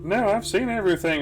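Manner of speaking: deadpan cat talking to the camera; a light imitation of a weary cat; cartoon cat. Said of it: "looks at the camera and says".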